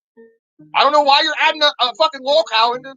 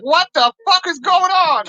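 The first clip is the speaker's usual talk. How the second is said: mock disbelief; 8 semitones higher, 4 dB louder, slower than usual. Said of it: "I'm like".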